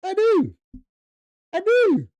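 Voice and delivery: very high, loud falsetto squeak; mock high-pitched cry; squeaky high reply.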